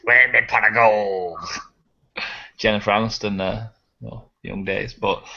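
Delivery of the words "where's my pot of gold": slow, lightly voiced Irish leprechaun; a hint of a cheeky leprechaun, slowed down. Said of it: "I would love the Leprechaun in Space House".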